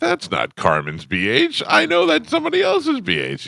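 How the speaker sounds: realisation jumping high on the second line; mock disbelief. Scrolling old photos, finding a stranger's tattoo, in disbelief. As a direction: act out spotting the wrong tattoo in disbelief. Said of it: "I'm like".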